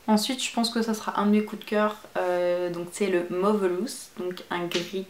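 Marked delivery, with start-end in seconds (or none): none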